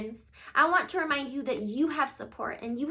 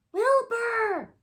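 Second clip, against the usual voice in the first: high loud call; shout; 11 semitones higher, 4 dB louder than usual. Acted. Francine shouting to wake Wilbur, loud. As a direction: call his name across the room. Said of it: "shouted Francine".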